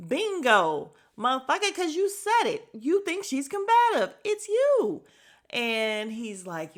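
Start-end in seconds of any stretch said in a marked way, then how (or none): 0.1-5.0 loud, high-pitched callout; acted-out "caught you" comeback at full volume; triumphant gotcha. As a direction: throw it back at him, climbing higher and louder.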